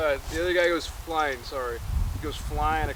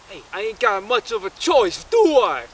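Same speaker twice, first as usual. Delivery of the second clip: louder, higher pleading to The Rock; loud plea; 7 semitones higher, 11 dB louder than usual. Retold or imitated. Chris Evans asks The Rock if he has a choice, pleading loudly. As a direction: raise it, plead for a way out.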